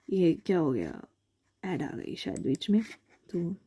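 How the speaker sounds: low, soft aside to herself; muttered aside. Real, not acted. Teacher to herself as an ad interrupts, quiet aside. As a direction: drop the voice, thinking aloud.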